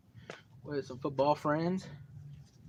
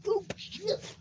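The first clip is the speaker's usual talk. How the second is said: much higher squeal of excitement; hyped. Real, not acted. Teller hyped about the coming fight, in a high squeal.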